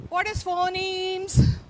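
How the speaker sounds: drawn-out prodding. Real, not acted. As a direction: stretch it out to prod the class. Teacher prods forgetful class.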